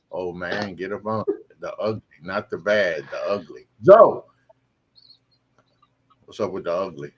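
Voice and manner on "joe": loud call across the studio. Real, not acted. Host calls out to Joe to bring him in, loud call. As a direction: shout his name to get his attention.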